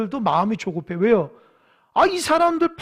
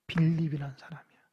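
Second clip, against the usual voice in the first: whisper; 3 semitones lower, 4 dB quieter than usual. Real, not acted. Aside naming the disciple.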